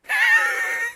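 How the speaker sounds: short high-pitched laugh at the joke; a quick delighted laugh. Real, not acted. Preacher laughing at his own joke, high delighted laugh.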